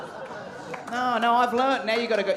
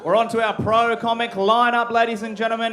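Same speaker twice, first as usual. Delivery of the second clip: MC announcement; 3 semitones higher, 9 dB louder than usual. Acted. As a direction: hype the crowd and bellow the name. MC introduces the act.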